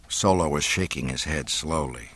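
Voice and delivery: usual speaking voice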